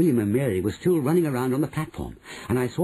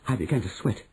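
ordinary delivery

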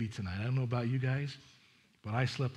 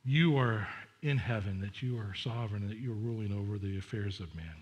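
prayer; 3 semitones lower than usual. Acted. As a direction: pray it gently, low and intimate. Closing prayer.

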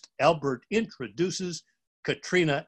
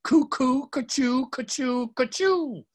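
high mock sneezes in a row; story alligator sneezing hugely; gigantic sneeze; 9 semitones higher than usual. A narrator's reading. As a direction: act out a big silly sneeze. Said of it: "a gigantic alligator sneeze".

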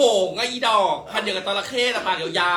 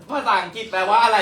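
ordinary talk

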